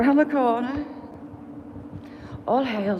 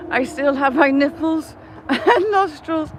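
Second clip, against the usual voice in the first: laughing; 6 semitones higher, 4 dB louder than usual. Retold or imitated. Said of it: "I thought you had said".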